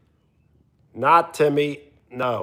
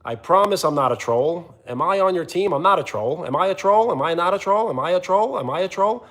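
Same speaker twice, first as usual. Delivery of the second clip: higher, rapid-fire needy questions, each lifting at the end; mocking a pestering viewer; 5 semitones higher, faster than usual. Streamer imitating a viewer who keeps asking about trolling, mocking. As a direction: mimic someone pleading over and over in a sing-song. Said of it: "you kept on doing the".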